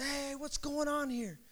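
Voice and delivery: slow, soft call-out; retold challenge. Preacher retelling walking in on a fight, restrained challenge. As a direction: step in with a drawn-out, held-back challenge.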